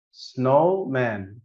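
سنومان stretched very slowly; slow model word. Shown as a demonstration. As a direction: drag out سنومان syllable by syllable. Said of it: "تاني".

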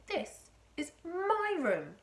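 high, slow, loud protest; indignant boy. A narrator's reading. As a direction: object as an affronted grandson. Boy protests about his room.

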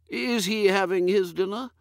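put-on vague old earl. Lord puzzling over dinner with his butler, high and dithery.

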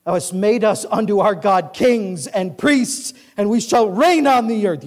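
high, loud preaching cadence; full-force scripture reading. Acted. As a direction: proclaim the verses like a revival preacher.

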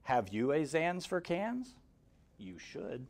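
plain reading voice

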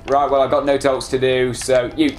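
ordinary talk

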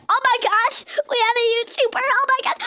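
high, squealy fan impression; mock excited fans. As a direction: imitate fans thrilled to spot a YouTuber. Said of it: "I bet everybody's like".

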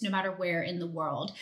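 ordinary talk, nothing marked

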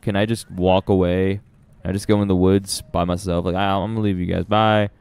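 ordinary talk

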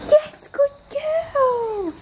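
very high, slow, sing-song praise; stretched-out, very high praise for the puppy. Real, not acted. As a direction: gush over the good dog in a squeaky voice. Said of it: "that is the tone".

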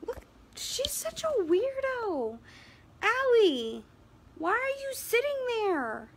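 high sing-song pet voice. Real, not acted. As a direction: coo at the dog. Cooing at the dog.

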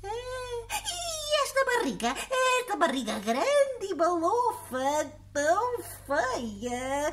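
put-on high, prying old lady voice; nosy old woman. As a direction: pester him like a nosy granny.

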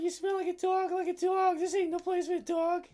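high, loud, mocking voice of a bouncer character; gruff tough guy. Bouncer voicing the game character, loud and high-pitched. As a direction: voice the rude doorman turning them away.

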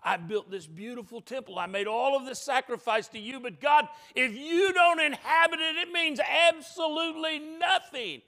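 prayer building to a high, loud peak; impassioned plea. Acted. Solomon pleading with God over the temple, impassioned. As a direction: rise from measured to fervent, emphatic preaching.

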